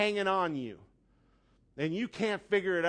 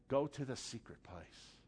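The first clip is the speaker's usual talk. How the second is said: much softer and lower, almost secret; soft, hushed God voice; 5 semitones lower, 12 dB quieter than usual. Acted. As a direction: draw them in with a hush. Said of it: "But God's saying".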